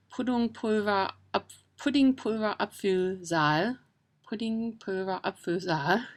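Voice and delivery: slow, careful German word demo; careful German pronunciation. Shown as a demonstration. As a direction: sound it out slowly in German. Carefully demonstrating a long German word.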